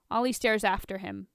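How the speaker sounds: usual speaking voice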